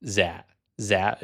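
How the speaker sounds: "Dạ" said as "za"; Northern pronunciation. Retold or imitated. Learner quotes the tutor's mistake, Northern z pronunciation. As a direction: start "Dạ" with a buzzing z. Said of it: "is a Northern pronunciation of that word".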